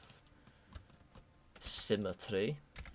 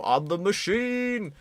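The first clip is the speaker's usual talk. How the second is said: slow, high, booming proclamation; bold declaration; 8 semitones higher, slower than usual. Acted. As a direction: announce it like a grand boast. Acting out the bold line to gangsters.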